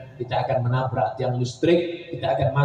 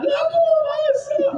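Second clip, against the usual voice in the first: high, crying cry to jump in; hammed-up widow begging to follow him; mock sobbing shout. Widow at the grave wanting to go in, mock sobbing shout. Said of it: "mereka nangis".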